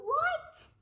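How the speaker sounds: high, loud startled what; shocked squeak. Acted. Principal shocked at losing his last name. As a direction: jump up high in disbelief.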